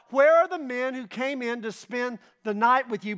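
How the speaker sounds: the men of Sodom call out to Lot, loud; mob shouting. Retold or imitated. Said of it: "they shout to Lot".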